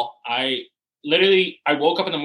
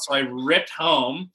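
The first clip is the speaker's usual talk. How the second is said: plain reading voice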